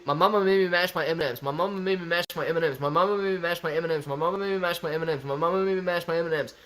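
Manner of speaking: fast run-on repetitions in a steady rhythm; rapid-fire tongue twister. Shown as a demonstration. Streamer showing off an easy twister, rapid-fire. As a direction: reel it off quickly, over and over.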